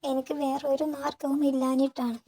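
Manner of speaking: put-on young wife pleading through tears; breaking down crying. A narrator's reading. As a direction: plead through tears not to be sent away. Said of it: "അവൾ മുഖം പൊത്തി പൊട്ടിക്കരഞ്ഞു".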